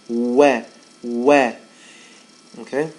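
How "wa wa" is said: wa ending in a smile, a bit louder; smiling wa. Shown as a demonstration. Teacher demonstrating a smiling light wa. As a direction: finish wa with a smile. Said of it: "you should be smiling".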